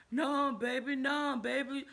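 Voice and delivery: high pleading mock man voice; a hint of a sobbing cheater; imitating crying man. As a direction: beg like a crying boyfriend. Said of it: "And he was crying".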